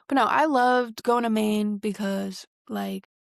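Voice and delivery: normal talking voice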